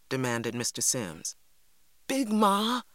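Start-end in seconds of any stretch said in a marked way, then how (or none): 2.1-2.8 balking plea. A narrator's reading. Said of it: "I balked".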